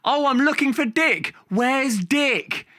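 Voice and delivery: high, hammed-up panto innuendo; panto actor's line. Panto actor teasing the crowd, hammed-up and high. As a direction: camp it up for the adults. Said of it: "they walk on stage and say".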